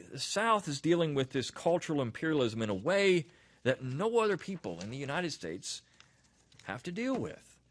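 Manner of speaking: ordinary delivery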